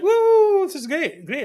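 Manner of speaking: high, loud whoop of delight; mock cheering voters. Presenter mimics voters cheering a Tory, high and loud. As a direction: whoop it like a thrilled fan. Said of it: "Scottish Tory voters were like".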